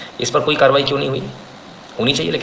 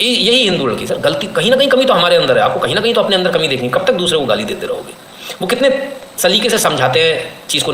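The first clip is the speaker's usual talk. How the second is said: ordinary delivery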